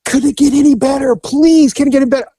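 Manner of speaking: giddy, loud begging; eager glee. Retold or imitated. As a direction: plead excitedly for the show to go on. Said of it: "I go".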